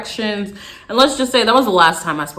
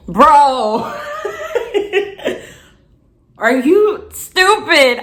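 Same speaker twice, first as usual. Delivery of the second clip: exasperated disbelief; 9 semitones higher, 4 dB louder, slower than usual. Real, not acted. I was so mad.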